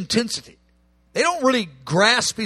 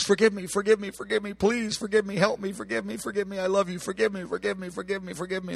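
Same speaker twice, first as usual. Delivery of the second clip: much softer, fading repeated begging; softly mimicking a fearful believer's endless plea for forgiveness; mock timid pleading; 9 dB quieter, faster than usual. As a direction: murmur it like a cowed, guilty prayer. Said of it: "with a spirit of rejection and condemnation".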